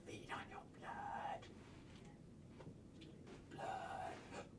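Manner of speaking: hushed, menacing whisper; creepy whisper. Pretend. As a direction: whisper it like a vampire. Vampire whisper.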